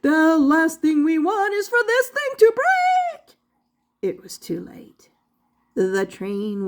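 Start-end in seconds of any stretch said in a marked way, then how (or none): 0.0-3.4 high, louder, alarmed shouting; panicked professor yelling over machine noise. A narrator's reading. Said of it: "The professor started shouting". 4.0-5.1 whispered, ominous; hushed storyteller whisper; dramatic whisper. A narrator's reading.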